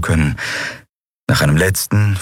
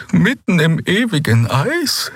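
high, slow, chilled question; shivering dismay; 12 semitones higher than usual. A narrator's reading. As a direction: shudder at the thought. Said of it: "Ein Frösteln ließ Xeas Körper erbeben". (hoch, langsam, fröstelnd)

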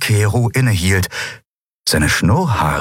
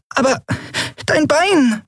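very high, small voice; squeaked. A narrator's reading. Nelen worried by Kero's bloody leg, squeaking. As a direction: squeak it out in concern. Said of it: "piepste Nelen".

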